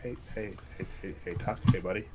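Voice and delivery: low, very soft little calls for attention; hushed calling. Acted. Leaning over to Tuck at the table, hushed. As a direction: get a friend's attention under your breath.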